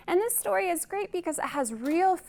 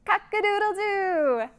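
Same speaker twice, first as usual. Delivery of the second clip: higher crowing cock-a-doodle-doo; rooster crow; 6 semitones higher than usual. Shown as a demonstration. Teacher shows kids the rooster, crowing high. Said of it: "what does he say".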